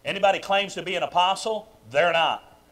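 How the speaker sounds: ordinary delivery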